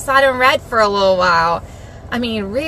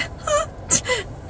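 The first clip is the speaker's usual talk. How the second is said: high, soft little laugh; 6 dB quieter than usual. Real, not acted. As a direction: laugh lightly at your own attempt. Embarrassed about practiced foreign words.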